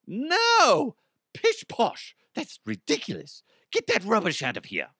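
high-pitched, hammed-up indignation; mock outrage. Host rejecting the idea of a mature podcast, mock outrage. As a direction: scoff theatrically, starting on a high cry.

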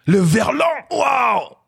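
rising to a high shout of joy on waouh; can't believe his luck, he shouts it out; stunned joy. Retold or imitated. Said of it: "j'ai dit". (incrédule, qui monte jusqu'à un cri de joie)

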